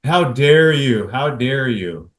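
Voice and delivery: hammed-up offended cry; mock outraged wife. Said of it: "the wife reacting".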